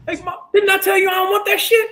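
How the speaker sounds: mimicking a beach vendor who won't leave her alone; pushy beach vendor.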